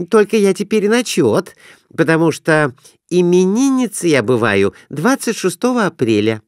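put-on high, girlish voice; eager wife asking to place a bet; young wife's voice. A narrator's reading. Wife talking at the table.

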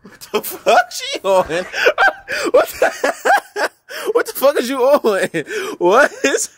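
cracking up mid-sentence; words breaking into laughter; laughing. Real, not acted. Laughing at friend's ad-lib.

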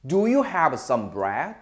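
slow, deliberate model question; slow model sentence. Shown as a demonstration. Teacher slowly reading the sentence for students to repeat. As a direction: say it slowly so students can follow. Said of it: "한번 여러분 직접 해볼게요".

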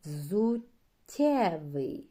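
Взуттєвий slow and loud with a long soft тт; slow model word. Shown as a demonstration. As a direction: stretch the тт in Взуттєвий. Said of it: "Так, що чуємо".

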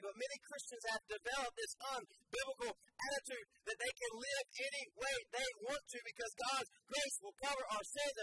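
normal talking voice

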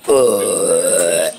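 long, low rolling belch; loud burp. Acted. Bert showing friends his problem, long burp. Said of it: "Bert, that smells".

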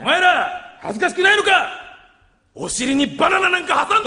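high, indignant teacher scolding. Acted. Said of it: "学園ドラマで". (生徒を叱る先生、高い声で)

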